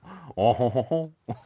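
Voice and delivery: put-on French "oh-ho-ho"; saucy mock-French laugh; French-accent chuckle. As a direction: chuckle like a hammed-up French flirt. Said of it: "Please do French accent".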